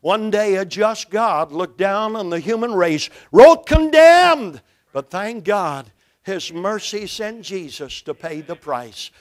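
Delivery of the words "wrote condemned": slow, loud, high proclamation; booming verdict. Acted. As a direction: hammer out each word like a sentence being passed. Preacher stresses God's verdict.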